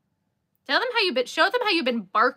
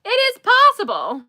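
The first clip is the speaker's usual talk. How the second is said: exclaiming; 13 semitones higher, 10 dB louder than usual. Real, not acted. Teasing about lost ball.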